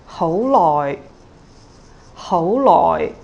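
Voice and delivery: hou rising, loi low; rising then low tone. Shown as a demonstration. Teacher models hou loi, rising then low. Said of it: "rising and low tone".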